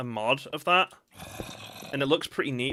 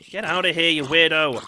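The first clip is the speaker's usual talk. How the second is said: loud, higher-pitched telling-off; shooing away; 3 semitones higher, 9 dB louder than usual. Real, not acted. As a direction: chase it off with a loud yell. Yells at creature that startled him.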